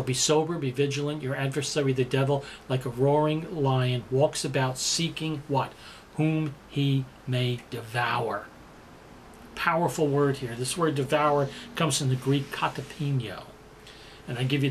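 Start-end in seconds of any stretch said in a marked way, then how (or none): 6.2-8.5 very slow and low, lean on Whom; slow ominous stress. Acted. Preacher ending the verse for the congregation, slow and low. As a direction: let devour sink in, slowly.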